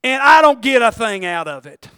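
loud, exasperated gripe, punching "boring"; imitating a frank, loud prayer; blunt complaint to God. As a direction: complain to the Lord at full voice. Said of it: "here's how I talk to God".

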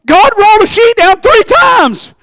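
high shouted defence; slow, loud and high, insisting. Retold or imitated. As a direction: shout it out slowly to the elders. Peter defends himself.

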